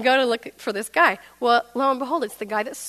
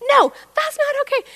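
loud, very high protest; outraged refusal; 6 dB louder than usual. Retold or imitated. Angry customer refusing the manager's deal, high outraged protest. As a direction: shut the offer down in a high squawk. Furious refusal in retold argument.

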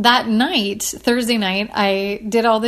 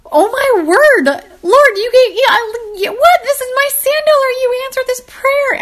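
much higher, louder squeal of joy; overjoyed squealing thanks to the Lord, much higher; giddy excitement; 5 dB louder than usual. Retold or imitated. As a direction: gush in delight at an answered prayer. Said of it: "I'm so excited".

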